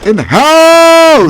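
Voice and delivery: drawn-out high, loud hype shout; lautes Ausrufen. Real, not acted. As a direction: holler it like a hype man, stretching the last word. Calls out a hype line.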